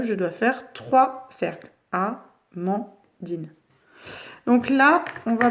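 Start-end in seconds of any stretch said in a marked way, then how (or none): 1.9-3.5 Amandine softly and slowly as A... man... dine; split into syllables. Shown as a demonstration. Teacher counting three circles, Amandine softly syllable by syllable. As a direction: pause after each syllable of "Amandine". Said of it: "il y a trois syllabes".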